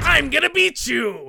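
high-pitched mock villain shout; evil guy voice. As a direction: yell it like a hammed-up cartoon villain. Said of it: "got his evil guy voice down".

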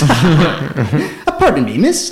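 high, swooping mock politeness, half laughing; exaggerated German-style manner. Stopping a woman on the street, hammed-up high-pitched manner. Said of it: "in a manner I'd never say it in English".

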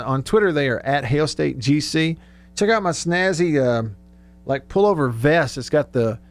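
normal talking voice

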